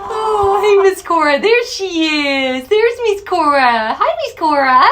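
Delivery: high sing-song pet voice; talking to a pet. Real, not acted. Greeting her chicken.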